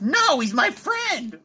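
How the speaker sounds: high, drawn-out protest; slow, high-pitched indignant objection; flustered denial. Acted. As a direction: deny it in acted embarrassment. Embarrassed denial of a crush.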